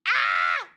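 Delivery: a sharp startled cry; startled yelp. Real, not acted. Startled outburst.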